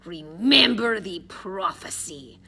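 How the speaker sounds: slow, weighty warning; slow villain's reminder; ominous Russian thug. A narrator's reading. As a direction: draw out each word with menace. Boris pulls him close, warns.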